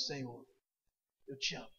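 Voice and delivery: soft, low, humbled reply; humble quiet answer. Acted. Peter answering Jesus, quiet and humbled. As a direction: answer him softly, like a man ashamed.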